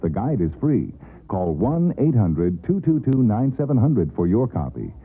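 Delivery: ordinary talk, nothing marked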